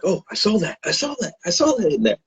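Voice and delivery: high-pitched, hushed excited squeal. Retold or imitated. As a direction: re-enact giddy recognition in a high, light voice. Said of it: "I got so, uh, excited when I saw it".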